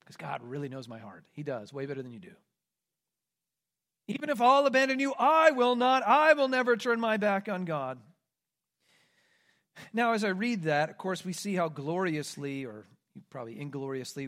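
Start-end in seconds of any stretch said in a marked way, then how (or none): 4.1-8.0 higher, louder, fast boast; boastful vow. Acted. Peter boasting to Jesus, loud proud vow. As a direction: declare it proudly. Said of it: "Peter's boast retold".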